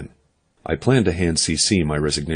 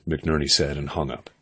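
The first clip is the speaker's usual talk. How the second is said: usual speaking voice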